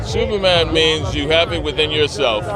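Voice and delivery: usual speaking voice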